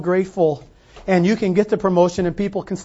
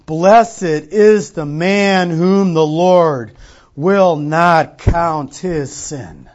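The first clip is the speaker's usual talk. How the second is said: scripture reading; 5 dB louder, slower than usual. Shown as a demonstration. Pastor reads scripture aloud.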